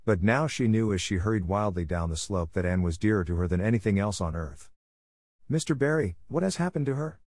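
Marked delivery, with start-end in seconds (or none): 5.5-7.2 high-pitched, alarmed question; gasping. A narrator's reading. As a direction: ask in sudden fear, pitch lifted high. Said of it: "she gasped".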